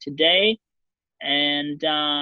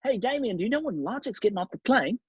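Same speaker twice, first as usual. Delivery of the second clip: a hint of an Australian accent, higher; light Australian mate impression, raised pitch; lightly voiced Australian Charlie; 6 semitones higher than usual. Host imitating Australian paparazzo Charlie, light Australian accent. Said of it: "Charlie, hey. / He's Australian".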